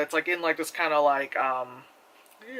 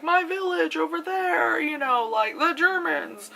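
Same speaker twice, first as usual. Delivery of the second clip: much higher, slow mock plea; pleading villager woman; 10 semitones higher than usual. Reviewer quoting a pleading village woman, higher and slow. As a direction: play a desperate villager, lightly hammed. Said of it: "a woman saying".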